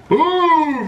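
a sharp high shout of pum as he lands the kill; shouted sound effect. Real, not acted. Gamer scoring a kill, shouted high pum.

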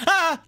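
sudden high yelp of fright; startled scream. Real, not acted. Startled by the game, a sharp high scream. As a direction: shriek as something jumps out. Jump scare in horror game.

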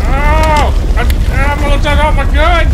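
yelling to the sergeant after losing the gun; loud panic. Acted. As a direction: keep yelling in a fluster. Lost gun, jumping in dark.

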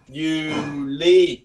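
"Juli" said YOO-lee, both vowels held long; drawn-out vowels. Shown as a demonstration. Teacher models the Norwegian month for students, drawn-out "Juli". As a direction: stretch "Juli" into two long syllables. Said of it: "Kéo dài cái âm".

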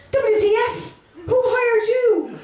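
high-pitched mock-excited mother; mother's delighted comment. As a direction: squeal it like a thrilled mam, drawing it out. Mother's comment on job news.

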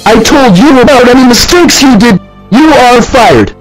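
full-on angry screaming; furious manager. Acted. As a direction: scream him out of the store. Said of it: "got screamed at by the manager".

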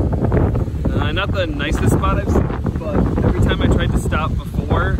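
shouting over wind. Real, not acted. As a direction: project over the gusts. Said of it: "A little windy still".

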